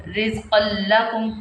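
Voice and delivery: "रिज़्क़ल्लकुम" with doubled l, no n sound; tanween merged into lam. Shown as a demonstration. Teacher giving a tanween example, doubled l in "रिज़्क़ल्लकुम". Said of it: "पढ़ना है हमने".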